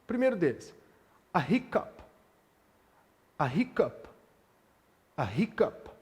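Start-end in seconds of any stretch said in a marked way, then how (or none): 1.3-5.9 teacher modelling "hiccup" with a breathy English H; aspirated h in hiccup. Shown as a demonstration. Teacher drilling students on "hiccup" with aspirated H. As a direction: sound the H in "hiccup" with a puff of air. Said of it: "O H em língua inglesa ... ele é aspirado".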